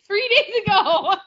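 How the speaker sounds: ordinary talk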